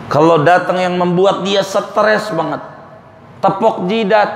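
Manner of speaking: ordinary delivery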